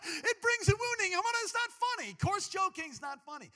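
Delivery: very high, loud, laughing through words; squealing with amusement; real helpless laughing. Real, not acted. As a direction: insist through uncontrollable laughter. Laughing while insisting it's unfunny.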